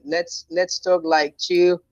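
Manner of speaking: slow, deliberate words to calm things down; slowly. Real, not acted. Debater asking opponent to talk calmly, said slowly. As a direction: spell it out slowly and evenly. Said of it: "Okay, slowly".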